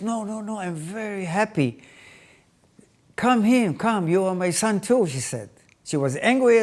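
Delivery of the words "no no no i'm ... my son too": thrilled welcome. Retold or imitated. Wife welcomes her husband's son at the door, thrilled. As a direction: eagerly call the newfound stepson in. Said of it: "she was thrilled to see".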